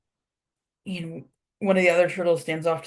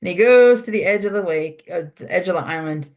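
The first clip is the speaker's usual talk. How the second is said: usual speaking voice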